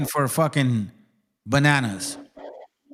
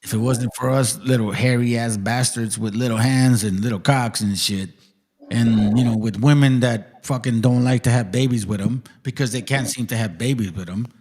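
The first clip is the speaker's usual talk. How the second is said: mocking the lecturer; 8 semitones lower than usual. Retelling the historian's claim in a lower mock voice. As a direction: lower mock voice of the speaker he quotes. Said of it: "he says that practically".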